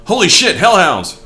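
high, loud cry of alarm; hammed-up shout of shock; acted alarmed exclamation. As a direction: yell it like a hero spotting monsters. Acting out a book character's alarm.

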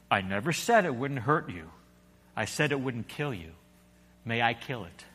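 low, soft, steady angel voice; a quiet, unhurried angel; calm angel. A narrator's reading. Angel answers.

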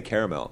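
"caramel" as CARE-uh-mel; three-syllable pronunciation. Shown as a demonstration. Host states own version, "caramel" as CARE-uh-mel. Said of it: "I say".

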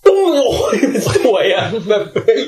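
giddy with awe; awestruck. Real, not acted. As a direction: relive the blast in amazement. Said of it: "มันเปิดโลกผมเลยนะ".

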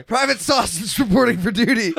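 loud, high military call; crisp loud soldier report. Pretend. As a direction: bark it like a private on parade.

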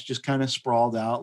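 ordinary delivery

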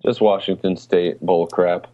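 ordinary talk

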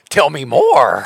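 loud, mock-eager child listener; eager kids. Voicing eager kids at circle time.

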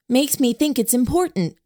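lightly voiced lip-read girl; Shipman voice. A narrator's reading. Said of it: "her Shipman voice".

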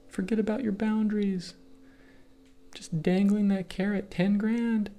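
whisper-coax him to break his rules; tempting devil voice. Pretend. The market tempting the trader to break his rules, high and soft.

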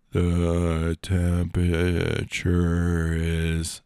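very slow, stretched assistant voice; slowed-down Alexa. Acted. Reprogrammed Alexa answers the unsuspecting friend, very slowly. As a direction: drag each syllable out like a slowed machine. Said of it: "it really slows shit down".

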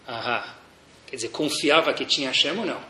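normal talking voice

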